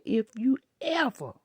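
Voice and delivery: retold furious warning to a child in Walmart; angry scolding. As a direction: lay down the law to a misbehaving kid. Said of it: "I said".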